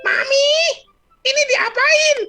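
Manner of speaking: very high, loud squeaky shout; shrieky kid calling from the bedroom; child yelling. Acted. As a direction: yell for mommy like a little boy. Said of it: "di kamar dia teriak".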